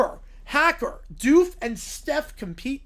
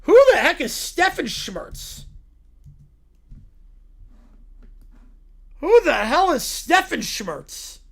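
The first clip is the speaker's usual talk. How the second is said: slow, drawn-out incredulous ask; baffled disbelief; 6 semitones higher, 7 dB louder, slower than usual. Real, not acted. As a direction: stretch each word in disbelief. Baffled repeated question.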